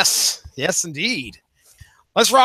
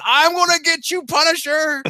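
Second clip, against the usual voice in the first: much higher mock villain cry; hammed-up crazed old woman lunging; Ma Nucci shriek; 12 semitones higher than usual. Ma Nucci flying at the Punisher, high mock cry. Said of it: "her flying through the air going".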